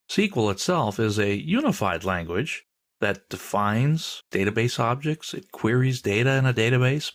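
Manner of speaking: usual speaking voice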